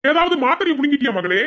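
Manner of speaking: loud, high-pitched scolding uncle. Acted. Uncle loudly scolding his niece to go eat. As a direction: bark at her to go eat.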